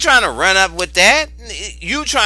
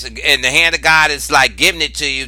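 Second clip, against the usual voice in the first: normal talking voice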